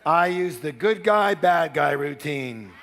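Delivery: slow, loud chant-like declaration; call-and-response lead. Shown as a demonstration. Seminar leader feeding audience a declaration, loud and slow. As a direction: proclaim it slowly for a crowd to echo. Leads audience in repeating the phrase.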